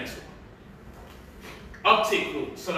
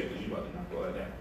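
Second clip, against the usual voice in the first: hushed warning; 12 semitones lower, 11 dB quieter, much faster than usual. Real, not acted. Father hushing his daughter near breakables, fast hush. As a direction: hush her quickly before she knocks it.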